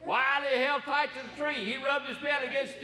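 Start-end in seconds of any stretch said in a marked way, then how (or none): none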